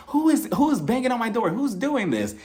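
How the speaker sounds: high-pitched mock outrage; imitated yelling. Kenya yelling at whoever bangs on her door, mock outraged. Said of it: "I think she starts yelling".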